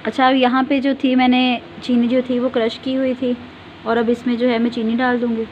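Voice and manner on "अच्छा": normal talking voice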